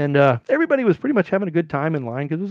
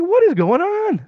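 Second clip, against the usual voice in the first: high, loud, drawn-out disbelief; mock-indignant, stretched words; bewildered protest; 5 dB louder, slower than usual. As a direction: act out a wronged man demanding answers. Protesting while being frisked.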